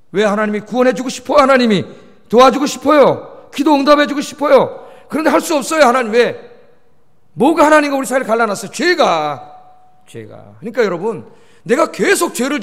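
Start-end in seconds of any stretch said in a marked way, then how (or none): 0.1-9.5 loud, higher-pitched pulpit questions and answers; forceful preaching. Real, not acted. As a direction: press each point on the congregation, building urgency. (높고 힘찬 설교조로 호소)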